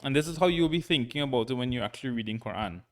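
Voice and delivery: normal talking voice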